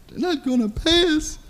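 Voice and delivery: high, soft tearful whimper; mock crying. Upset lady tells a coworker about the pay, mock crying. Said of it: "she was crying".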